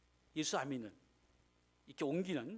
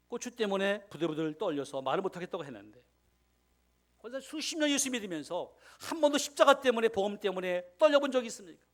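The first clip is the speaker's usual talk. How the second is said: loud, much higher preacher's challenge; fiery rebuke; 6 semitones higher, 11 dB louder than usual. Retold or imitated. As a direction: thunder the question at her. Pastor rebukes deaconess.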